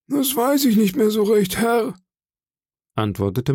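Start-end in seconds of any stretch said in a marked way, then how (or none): 0.1-1.9 very high, thin-witted deferential voice; meek evasive sailor. Acted. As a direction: plead ignorance to the boss, high-pitched. Cowed sailor gives an evasive answer.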